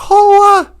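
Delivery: loud, high mock gasp of wonder; amazed gasp. Holly sees a giant strawberry, mock amazement. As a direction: gasp at something huge. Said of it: "she's like".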